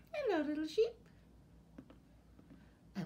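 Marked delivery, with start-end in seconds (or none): none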